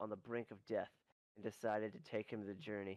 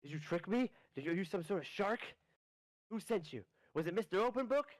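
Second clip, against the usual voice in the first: rising panicky questions, higher each time; mock panic; 7 semitones higher than usual. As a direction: accuse them in a hammed-up panic. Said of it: "he's panicking".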